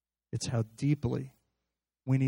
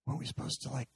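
whisper; 9 dB quieter, faster than usual. Acted. Voicing a doubting believer.

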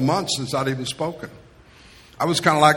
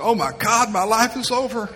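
put-on higher, overdone teenage despair; dramatic teen wail; 7 semitones higher than usual. Teen after a breakup, wailing to a parent. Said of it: "they'll come and they'll say".

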